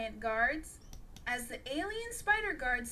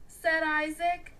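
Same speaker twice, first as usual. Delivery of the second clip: ordinary delivery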